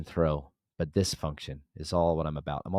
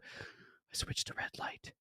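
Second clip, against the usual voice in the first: slow, hushed build-up; suspenseful whisper; 14 dB quieter, much slower than usual. Real, not acted. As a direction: whisper it slowly before the big reveal. Suspense before the reveal.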